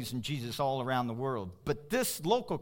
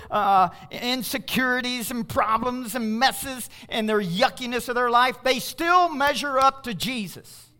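preaching at full force; 7 semitones higher, 7 dB louder than usual. Real, not acted. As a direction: drive it home to the congregation.

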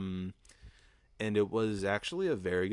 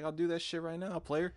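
pitched-up, tipsy bravado; a hint of drunk bravado; cocky eager reply; 8 semitones higher than usual. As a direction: accept the dare with swagger. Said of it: "I was like".

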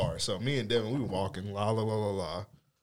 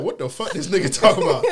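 loud, laughing disbelief; baffled, half laughing; confused; 7 semitones higher, 7 dB louder than usual. Retold or imitated. Confused at the man.

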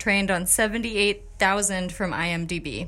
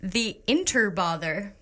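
ordinary talk

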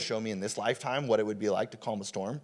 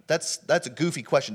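usual speaking voice

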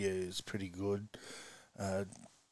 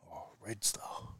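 hushed whisper in a crowded shop; 8 dB quieter, slower than usual. Retold or imitated. Whispering his team to the butcher. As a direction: breathe it out so nobody hears. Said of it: "So I whispered".

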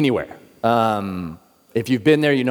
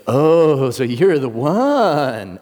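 long, drawn-out eerie recognition; possessed woman; 2 semitones higher, much slower than usual. Retold or imitated. Possessed woman turns around and looks at him, drawling knowingly. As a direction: stretch it out slowly, swooping on the last word.

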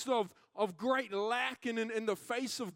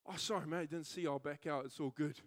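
imitated mild driver, low and soft; easygoing apology; 8 semitones lower, 6 dB quieter than usual. Other driver to angry man over car park, low, easygoing apology. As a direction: back down quietly, no fuss at all.

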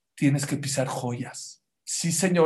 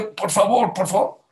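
pleading cry; 5 semitones higher, 3 dB louder than usual. Retold or imitated. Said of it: "Le lloró, le gritó".

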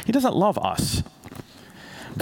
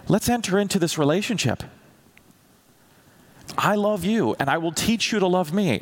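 higher, tender wooing plea; God's tender invitation; 7 semitones higher than usual. Retold or imitated. God wooing the believer, tender and higher. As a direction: coax him into the relationship. God's loving overtures voiced.

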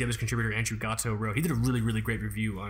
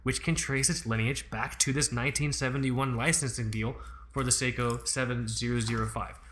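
normal talking voice

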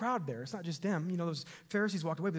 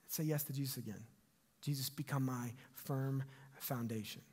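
soft prayer; 4 semitones lower, 8 dB quieter than usual. Acted. Leads prayer of recommitment.

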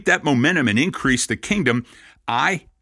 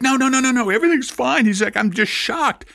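much higher, startled no-no-no; shocked reassurance; 12 semitones higher than usual. Retold or imitated. Rabbi imitating a startled Cahn reassuring him, much higher. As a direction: play him surprised and reassuring at once.

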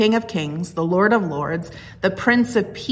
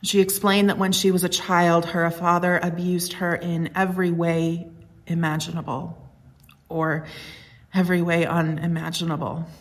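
normal talking voice

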